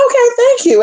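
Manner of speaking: very high, sweet coy thanks. Retold or imitated. Answering his compliment, very high and coy. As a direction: play it bashful and high.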